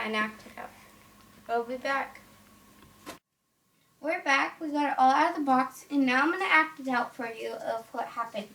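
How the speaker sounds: ordinary talk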